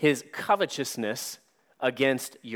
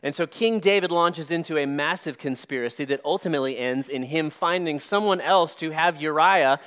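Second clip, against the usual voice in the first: ordinary talk, nothing marked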